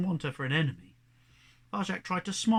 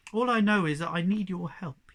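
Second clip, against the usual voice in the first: ordinary delivery, nothing marked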